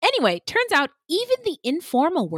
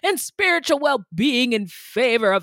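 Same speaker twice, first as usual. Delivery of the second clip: pious moralizer. Retold or imitated. Voicing prudish moral scolds.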